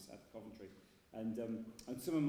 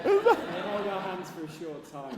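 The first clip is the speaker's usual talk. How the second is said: laughing emotionally; 7 semitones higher, 18 dB louder, slower than usual. Real, not acted. Tearful laughing sentiment.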